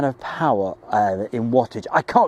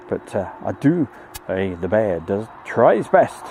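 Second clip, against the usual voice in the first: ordinary delivery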